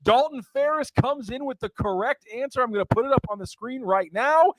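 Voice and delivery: high-pitched, excited winner announcement; game-show announcer. Real, not acted. Host revealing the trivia winner and answer, in high announcer voice.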